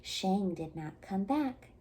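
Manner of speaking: ordinary delivery, nothing marked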